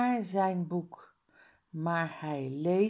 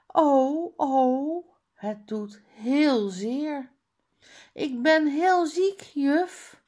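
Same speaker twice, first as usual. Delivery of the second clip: fake moaning; 7 semitones higher, 6 dB louder than usual. Tom fakes illness to teacher.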